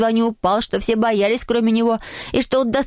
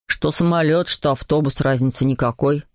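put-on much lower, unimpressed boy's voice; dismissive schoolboy; 7 semitones lower than usual. A narrator's reading. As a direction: play down the boast in a low voice. Boy dismisses the plane boast.

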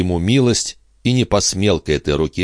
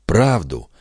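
usual speaking voice

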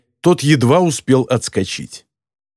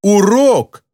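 high outraged exclamation, falling hard; furious retort; 7 semitones higher than usual. A narrator's reading. Girl throws the word back at her teacher, furious. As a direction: repeat the word as an indignant protest. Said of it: "яростно завопила она в ответ".

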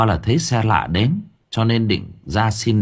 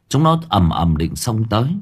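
ordinary delivery, nothing marked

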